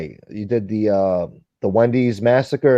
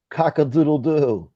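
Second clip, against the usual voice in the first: slow, higher mock rooster call; rooster crow; 5 semitones higher, slower than usual. Imitating rooster crowing.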